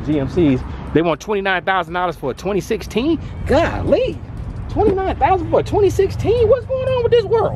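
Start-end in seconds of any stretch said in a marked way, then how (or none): 3.5-7.6 much higher, squeaky disbelief; high-pitched exasperation at a used truck's price; comic outrage. Real, not acted. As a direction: squeal your shock at the price.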